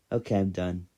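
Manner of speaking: ordinary talk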